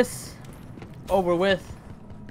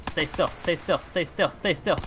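normal talking voice

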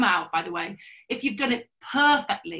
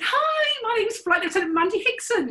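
bright greeting; 6 dB louder than usual. Retold or imitated.

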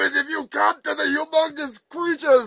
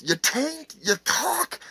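normal talking voice

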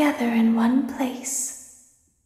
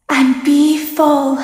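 booming command; 9 dB louder, slower than usual. Acted. Villain climax command.

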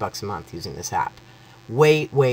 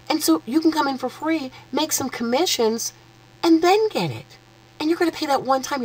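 much higher, racing, bubbling over with glee; giddy excitement; 11 semitones higher, faster than usual. Real, not acted. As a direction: gush about the deal, can't contain it. Gushing sales pitch to viewers.

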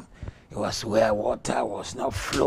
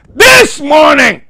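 high ringing shout, drawn out; shouted proclamation; 21 dB louder, slower than usual. Real, not acted. Pastor shouting a declaration to the church. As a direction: lift the room with a cry.

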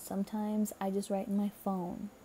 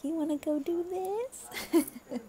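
high, drawn-out eager invitation; coaxing fiance; 8 semitones higher, slower than usual. Acted. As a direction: stretch it out high, angling for a yes. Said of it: "that look from your fiance that's like".

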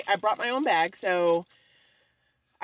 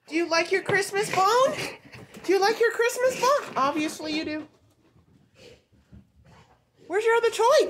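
much higher, sing-song voice for the dog; 11 semitones higher than usual. Real, not acted. Owner coaxing her dog to play, high baby talk.

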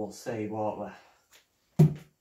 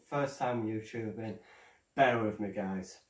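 normal talking voice

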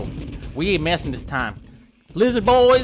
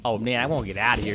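usual speaking voice

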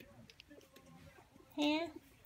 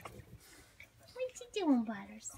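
drawn-out coo to Butters; slow stretched coo; 4 dB quieter, much slower than usual. Real, not acted. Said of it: "repeated question".

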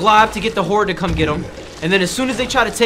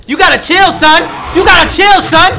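building shout; 9 semitones higher, 9 dB louder than usual. Real, not acted. Taunt repeated, rising.

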